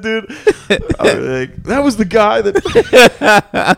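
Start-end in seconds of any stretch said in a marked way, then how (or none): 1.6-3.8 slow, laughing, higher and louder; laughing mock accusation. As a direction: drag it out through a laugh, pointing him out.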